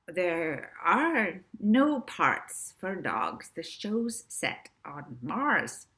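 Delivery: slow, dreamy objection; hypnotised agent objecting, very slow; dazed Rixie. Acted. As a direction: drift through it half-asleep.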